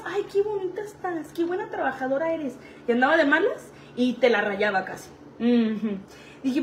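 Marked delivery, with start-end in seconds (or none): 0.1-2.6 higher, softer mock flattery; soft, higher sugary compliments; sweet-talking manager. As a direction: imitate the moody boss in a good mood. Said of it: "Andaba de buenas".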